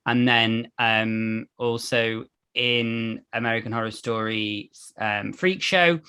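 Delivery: normal talking voice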